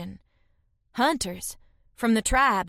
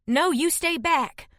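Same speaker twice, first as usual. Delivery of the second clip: higher, slow, urgent hold-back; urgent warning; 8 semitones higher than usual. A narrator's reading. Protecting feverish Gren from intruders.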